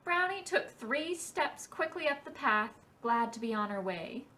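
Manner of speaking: ordinary delivery, nothing marked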